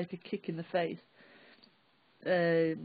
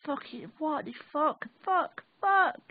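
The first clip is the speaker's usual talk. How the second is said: przekleństwa rzucane coraz wyżej i głośniej; narastające, piskliwe klnięcie z niedowierzania; swearing at him; 10 semitones higher, 3 dB louder, slower than usual. Retold or imitated. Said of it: "I did swear at him a lot".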